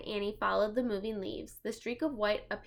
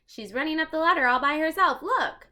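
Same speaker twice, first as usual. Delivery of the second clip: high, fast, loud eagerness; excited girl; 7 semitones higher, 8 dB louder than usual. Acted. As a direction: urge him to look.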